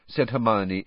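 usual speaking voice

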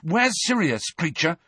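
high, urgent question; demanding. A narrator's reading. Boy questioning the house elf, demanding. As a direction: demand an answer, pitched high. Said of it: "Harry demanded".

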